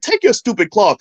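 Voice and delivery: high-pitched mock-angry comeback; retold angry retort. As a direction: toss it back, acting out a quarrel. Retold quarrel with the vendor.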